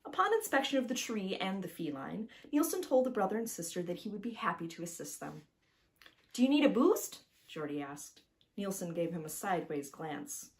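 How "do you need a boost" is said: put-on high, eager little boy voice; young boy. Little Jordy introducing himself to Nielsen, high and eager. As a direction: pitch it up like a seven-year-old.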